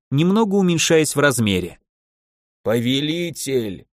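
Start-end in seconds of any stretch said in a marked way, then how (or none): 2.6-3.9 медленно, тише, булькающим чужим голосом; водный дух булькает; булькающий возглас. A narrator's reading. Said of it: "На весь зал побулькал". (булькающий голос духа)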